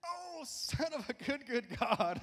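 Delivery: very high, slow, rising to a loud cry; exclaiming in awe. Pretend. Pastor crying out his daughter's phrase in high wonder. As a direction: burst out in amazed praise. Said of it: "Sometimes it's just like".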